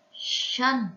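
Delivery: "Sân" with heavy s and ân rhyme; careful syllable model. Shown as a demonstration. Teacher shows pupils a hard word, "Sân" pronounced carefully with a heavy s. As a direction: sound out "Sân" alone, heavy s at the start. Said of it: "âm sờ mặn và vần ân".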